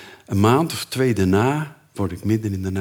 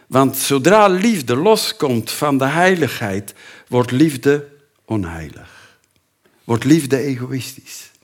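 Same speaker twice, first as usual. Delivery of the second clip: raised, insistent sermon voice; emphatic preaching; 4 semitones higher, 4 dB louder, slower than usual. Acted. Preacher warns the church about unholy love, insistent. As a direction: drive the point home from the pulpit. Preacher drives the point home.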